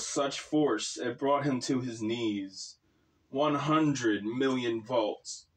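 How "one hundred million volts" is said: ordinary delivery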